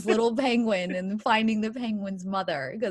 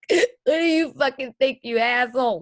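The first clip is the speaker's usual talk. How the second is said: mock tearful snap, louder and higher; tearful retort; 3 semitones higher, 6 dB louder than usual. Woman retorts to ex-husband asking if she's okay, tearful retort. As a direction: snap back through mock tears. Retells snapping back while crying.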